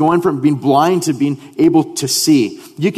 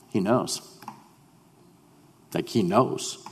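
hushed realization; 5 semitones lower, 10 dB quieter than usual. Acted.

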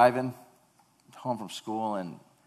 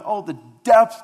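exclamation of awe; 10 semitones higher, 14 dB louder than usual. Acted. Exclaiming Paul's words.

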